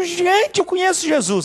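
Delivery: excited announcement. Acted. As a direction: burst out the news to everyone.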